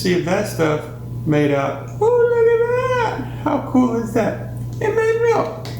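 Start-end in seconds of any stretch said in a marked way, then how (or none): 2.0-3.4 surprised gasp. Real, not acted. Milk appears in the jar. 4.8-5.7 amazed. Real, not acted. Result of experiment.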